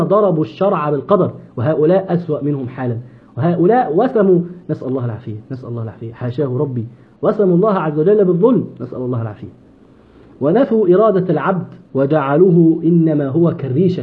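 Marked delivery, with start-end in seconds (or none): 4.7-6.9 quiet pious aside. Real, not acted. 8.8-9.5 quiet pious aside. Real, not acted.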